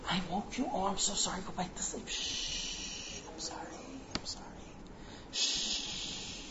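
whispered, fussing apology with shushes; hushed apology. Retold or imitated. Wife to the husband she just woke, hushed apology. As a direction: shush him back to sleep in a whisper.

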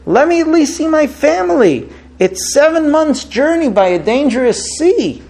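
high-pitched mild imitation of pleading; tearful plea, retold. Traveler begs judges to see his family, mildly acted tearful plea. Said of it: "And he's crying".

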